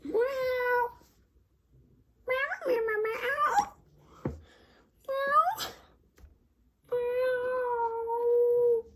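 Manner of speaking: high, drawn-out kitty meows; cat meows. Pretend. Playing a cat for the viewers, high meows. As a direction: meow like a little cat. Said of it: "I was pretending that my voice transformed into a meow".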